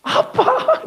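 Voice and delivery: very high, delighted shout of Daddy; joyful cry. Retold or imitated. A preacher voicing an orphan's joy at finally having a father, very high. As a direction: burst out like a child finding a father. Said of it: "자기한테 아빠가 생긴 거예요".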